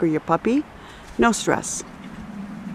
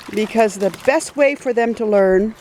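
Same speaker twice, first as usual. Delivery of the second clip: normal talking voice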